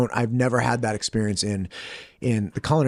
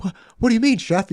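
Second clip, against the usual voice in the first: flustered retort; 10 semitones higher, faster than usual. Retold or imitated. Acting out flustered former cook confronted.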